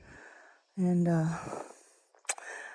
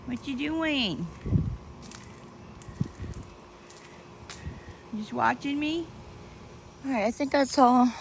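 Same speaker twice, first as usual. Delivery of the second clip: talking to a pet; 5 semitones higher, 5 dB quieter than usual. Real, not acted. Talking to her dog Pixie.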